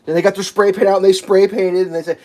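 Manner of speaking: normal talking voice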